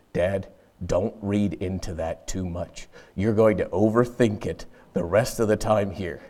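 low, soft word of advice; quiet caution. Retold or imitated. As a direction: gently warn him off, keeping it low and soft. Son gently cautions his dad.

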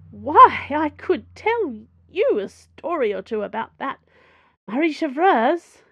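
bravely volunteered. A narrator's reading. Madame Sue eagerly offers the cardinal gossip, loud and bold. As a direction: jump in eagerly, ready to dish gossip. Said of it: "she volunteered bravely".

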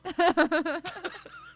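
loud, high burst of laughter; real laughing fit. Real, not acted. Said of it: "You're laughing".

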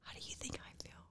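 hushed whisper. Real, not acted. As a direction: whisper so Dad can't hear. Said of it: "Why are you whispering?".

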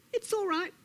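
high-pitched placating lizard; soothing Lizard. Acted. Lizard reassures Snake, placating.

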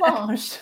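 Planche as "plahsh" with a laugh; accented surname. Shown as a demonstration. Host joking with guest about her last name, accented pronunciation. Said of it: "like, an accent".

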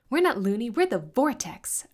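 high, loud indignant retort; shouting. A narrator's reading. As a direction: shout it back proudly. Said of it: "Isis shouted".

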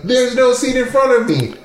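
high, baffled disbelief; surprised. Retold or imitated. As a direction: act shocked at the missing seat. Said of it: "and you're like".

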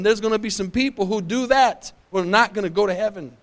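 usual speaking voice

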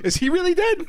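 high-pitched mock eagerness; squeaky high question. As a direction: ask God like a starstruck fan. Asking God about [name].

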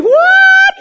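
shrill, loud, sky-high what; hammed-up indignant yelp; angry what. Shown as a demonstration. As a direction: show how angry people squawk. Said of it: "you can always tell when people are angry".

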